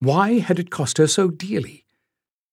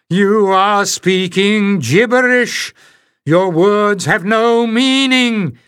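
high, loud, slow furious shout; 7 semitones higher, 5 dB louder, slower than usual. A narrator's reading. Oris with hands over his ears, shouting at the strangers, furious high shout. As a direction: hurl the words at them, slow and high. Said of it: "he shouted".

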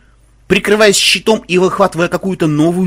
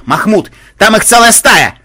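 high, loud alarm call; loud raised-pitch warning shout; urgent warning; 7 semitones higher, 8 dB louder than usual. Acted. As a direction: call out danger to a comrade.

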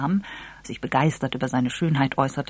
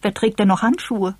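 put-on higher, let-down girl's question; dismayed and raised in pitch; disappointed; 6 semitones higher, 4 dB louder than usual. A narrator's reading. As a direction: protest in girlish disappointment. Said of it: "rief Eve enttäuscht".

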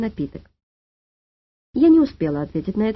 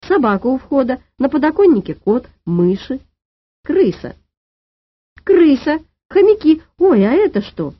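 exasperated list rising to loud cries; high, louder, building to shrieked alarm; losing her temper; 5 semitones higher, 5 dB louder than usual. A narrator's reading. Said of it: "окончательно вышла из себя дама". (вне себя от возмущения)

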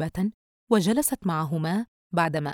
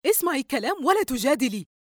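higher-pitched cross reprimand; angry rebuke; 7 semitones higher than usual. A narrator's reading. Mother scolding daughter for arguing, angry. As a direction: shut down the argument angrily. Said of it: "الأم ناهرة بغضب".

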